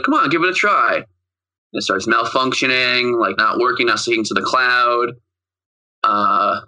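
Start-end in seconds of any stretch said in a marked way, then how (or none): 0.0-1.0 mild mock encouragement; coaxing mom. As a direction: lightly urge like a mom. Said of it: "And she's like".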